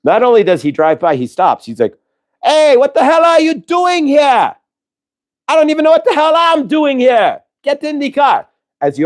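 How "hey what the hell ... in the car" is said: much higher, put-on Jamaican accent calling out; a cheerful Jamaican driver hailing hitchhikers from his car. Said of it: "He sounded Jamaican".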